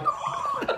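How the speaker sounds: imitated gasp of wonder; mock awed gasp. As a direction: re-enact a starstruck intake of breath. Retold own awed reaction.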